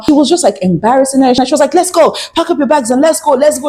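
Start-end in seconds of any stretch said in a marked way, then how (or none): 1.7-3.7 urgent mother. Retold or imitated. Angry mother voiced in retold quarrel.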